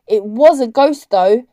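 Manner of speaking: loud parting call; calling after someone. A narrator's reading. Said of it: "Fenella called after Julian".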